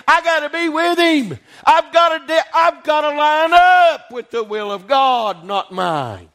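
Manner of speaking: fervent preacher crescendo; rising preaching intensity. Real, not acted. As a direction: build to a fervent climax. Preacher building to climax.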